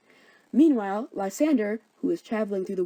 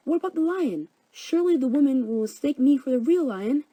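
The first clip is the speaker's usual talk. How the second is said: worried Snug; 6 semitones higher, 4 dB louder than usual. Acted. Snug voiced, high worried.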